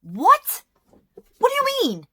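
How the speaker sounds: high, loud squeal of disbelief; shocked disbelief. Retold or imitated. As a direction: shriek it like you can't believe it. Mock reaction to accusation.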